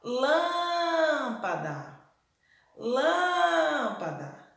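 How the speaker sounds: "lâmpada" called out with a very long "lâm"; "lâmpada" sung out slowly, leaning on "lâm"; stress on the first syllable. Shown as a demonstration. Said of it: "a sílaba que mais se alongou".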